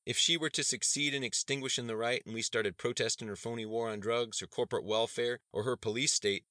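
loud, high-pitched tirade; impassioned rant. A narrator's reading. Activist warning students about a leader, impassioned rant. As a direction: rouse the crowd against her.

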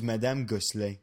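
put-on light French accent on a name. Guest naming his French teacher, French accent. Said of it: "I only say that with a French accent".

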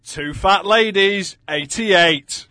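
bingo caller. Acted. Bingo number call opens episode.

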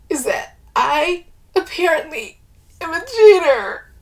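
high, tearful quiver. A narrator's reading. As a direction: confess haltingly, on the edge of tears. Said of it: "she says in a quivering voice".

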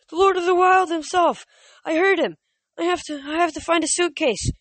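high, loud frantic yelling; panicked satyr. Acted. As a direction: panic like a terrified goat-man. Grover panicking after wail.

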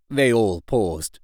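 usual speaking voice

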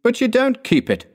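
high-pitched, lively objection; protesting nephew. A narrator's reading. Plays Scrooge's nephew.

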